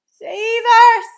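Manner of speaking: high, very loud scream for help. A narrator's reading. Giant screaming in his nightmare, terrified shriek. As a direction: scream it out in full-blown terror. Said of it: "screamed the flesh lump eater".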